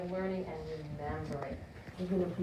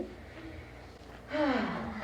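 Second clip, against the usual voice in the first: demonstration sigh; 3 semitones higher than usual. Shown as a demonstration. Breathing exercise demo.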